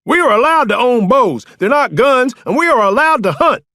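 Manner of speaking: high, loud outraged rant; spitting mad. A narrator's reading. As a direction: shout your complaint in fury. Furious arrested man argues.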